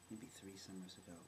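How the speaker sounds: ordinary talk, nothing marked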